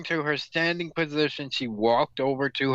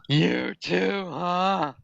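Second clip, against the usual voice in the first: higher, lightly gravelly character line; a hint of gravel, pitched up; 6 semitones higher, much slower than usual. A narrator's reading. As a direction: read it with a touch of gravel. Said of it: "Her voice was gravelly as she spoke".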